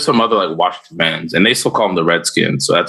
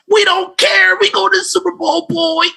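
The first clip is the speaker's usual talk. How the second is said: high, shouting, cocky fan voice; Cowboys fan. Pretend. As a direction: crow like a boastful football fan. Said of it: "Cowboys sound like this".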